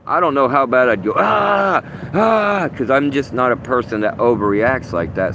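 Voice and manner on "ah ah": mock scream. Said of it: "scream in terror".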